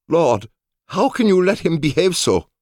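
very high, indignant appeal; outraged guard. A narrator's reading. Samurai guard appeals to his master, high indignant protest. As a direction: plead in high-pitched disbelief.

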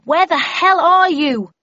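slow, loud, high demand; drawn-out, full-voiced shout. A narrator's reading. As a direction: yell it out to someone out of sight. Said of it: "I shouted at the top of my voice".

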